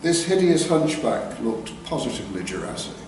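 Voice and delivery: ordinary delivery, nothing marked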